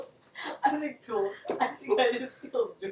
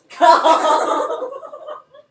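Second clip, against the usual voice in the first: shrieking laughter; 14 dB louder than usual. Real, not acted. Laughing hard.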